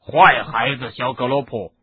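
loud scolding shout. A narrator's reading. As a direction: bellow at the naughty giant. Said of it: "海格大声喊道".

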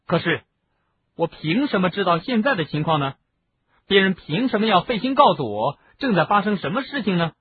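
high, indignant questioning; aggrieved outburst. A narrator's reading. Acted aggrieved outburst in a quarrel.

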